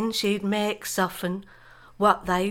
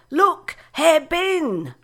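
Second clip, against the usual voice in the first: slow, high, loud excited call; 8 semitones higher, 6 dB louder, much slower than usual. Retold or imitated. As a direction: call it out with childish glee. Sister calling out, Christmas morning.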